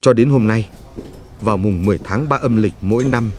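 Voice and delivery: ordinary delivery, nothing marked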